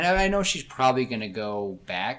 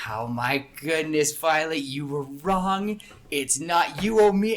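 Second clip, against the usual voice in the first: drawn-out, higher crow of triumph; gleeful gloating; 5 semitones higher, 5 dB louder than usual. Acted. Climber tells his companion she lost the bet, gleeful gloating. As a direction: slowly savour being right, voice climbing louder. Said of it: "he starts laughing even more".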